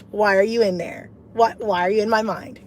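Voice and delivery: usual speaking voice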